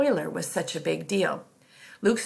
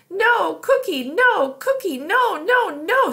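high, loud tantrum wail; inconsolable toddler; 13 semitones higher, 9 dB louder, slower than usual. A narrator's reading. Toddler offered a cookie too late, inconsolable wailing. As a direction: wail like a toddler past calming. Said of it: "way past the point of being pacified".

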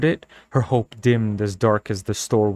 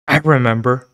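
louder, impatient; 2 semitones higher, 6 dB louder than usual. Acted. Girl impatiently answering the store owner, curt. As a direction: snap it off impatiently. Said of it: "replied impatiently".

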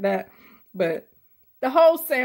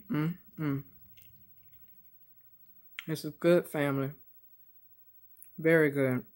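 chewing while humming; talking between bites; mouth full; 4 semitones lower, 7 dB quieter, faster than usual. Real, not acted. Seen 2: Fry bitten at -1; closed mouth shifting during mm sounds.

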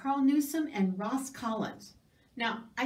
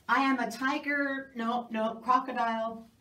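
usual speaking voice

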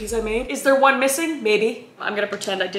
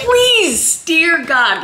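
loud, high, hammed-up plea; mock begging; 6 semitones higher, 7 dB louder, much slower than usual. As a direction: beg the viewers desperately, playing it up. Begs viewers to like the video.

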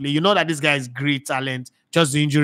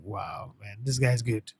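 breathless, hushed wow of admiration; hushed awe; 5 semitones lower, 11 dB quieter, slower than usual. Retold or imitated. Host hearing Felix do Pidgin, hushed awe. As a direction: murmur it, floored by the skill. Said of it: "I'll be like".

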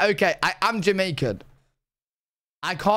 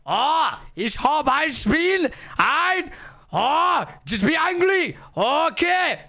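high-pitched, angry German shouting; German accent; 5 semitones higher, slower than usual. Shown as a demonstration. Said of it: "the only accent I could do really well is German".

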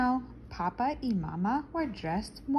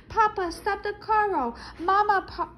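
put-on frantic child; 10 semitones higher, 4 dB louder than usual.

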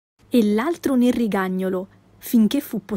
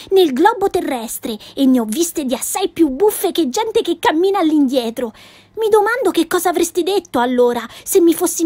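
put-on a touch higher, spirited girl defending herself; bright cheeky girl voice, lightly done; a hint of a feisty little girl protesting; lightly voiced Pippi, indignant; 6 semitones higher than usual. Pippi boasting of travels.

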